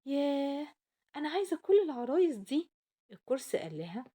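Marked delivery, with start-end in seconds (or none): 0.1-2.7 high, loud delighted squeal. Acted. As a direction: gush with joy like a child. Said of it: "كانت فرحانة جدًا وقالت".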